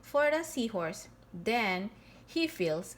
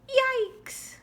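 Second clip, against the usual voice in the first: much higher, sudden yelp; startled yelp; 13 semitones higher than usual. Acted. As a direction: jump out of your skin. Shadow of a whale.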